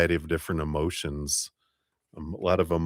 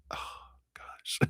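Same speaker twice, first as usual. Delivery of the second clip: breathy whispered oh gosh; whispered dismay; 19 dB quieter than usual. Retold or imitated. Reacting to a useless answer, whispered dismay. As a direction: mutter it in private despair. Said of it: "and you're like".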